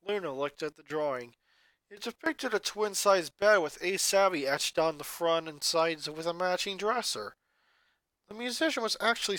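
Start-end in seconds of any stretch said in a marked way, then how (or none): none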